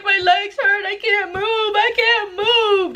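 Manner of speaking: high-pitched mock wail, last words drawn out; hammed-up tearful whine of pain; imitated crying. Telling friends her legs hurt, high imitated crying. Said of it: "I'm over there crying".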